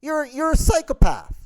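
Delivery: way up high, exasperated squeal; shrill outburst. Acted. Comic ranting at price matchers, squeaky exasperated outburst. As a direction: crack up into a high disbelieving squeal.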